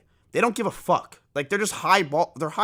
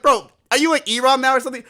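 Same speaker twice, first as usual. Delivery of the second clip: indignant; 7 semitones higher, 6 dB louder, faster than usual. Retold or imitated. Indignant at videos shown.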